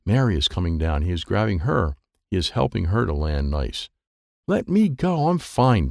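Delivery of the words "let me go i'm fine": indignant protest. A narrator's reading. Mary refusing Dwayne's help.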